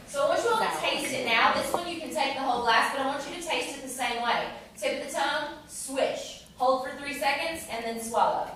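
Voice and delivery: ordinary delivery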